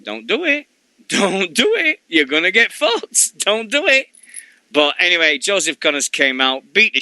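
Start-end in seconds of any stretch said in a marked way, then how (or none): 0.0-4.1 high anxious urging; mock pleading. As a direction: beg them to stop, half joking. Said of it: "I'm sat there going".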